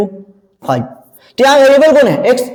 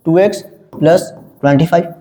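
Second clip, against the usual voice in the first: "टू x, plus, twenty five" in separate, lower chunks; piece by piece; 6 semitones lower than usual. Shown as a demonstration. Teacher writes out the expression for class, piece by piece. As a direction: dictate it with gaps between the terms.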